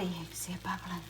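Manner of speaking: soft hushed whisper. Real, not acted. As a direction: lean in and whisper it. Showing the bubbling biscuits.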